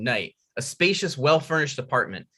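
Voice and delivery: ordinary talk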